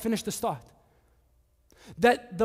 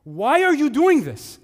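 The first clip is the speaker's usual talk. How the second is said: higher, louder pleading shout; shouting apostles; 7 semitones higher, 6 dB louder than usual. Acted. Paul and Barnabas shouting at the worshipping crowd, higher and louder. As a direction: cry out to stop the crowd. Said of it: "rushed into the crowd shouting".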